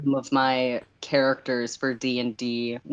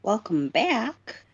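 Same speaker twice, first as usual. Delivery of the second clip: slow welcome back; 7 semitones higher, slower than usual. Acted. Streamer greeting viewers.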